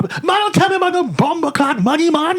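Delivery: high-pitched indignant mock Jamaican; refuse, offended, in a put-on Jamaican accent; imitated Jamaican Rasta getting worked up; upset Jamaican accent. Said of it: "He actually gets upset".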